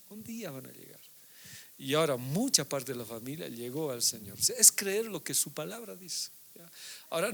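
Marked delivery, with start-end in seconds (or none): 0.1-1.0 repetido mucho más bajo, casi para sí; un día van a llegar, repetido en voz baja como una promesa íntima; eco suave. Retold or imitated. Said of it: "y decía". (repetición susurrada con fe)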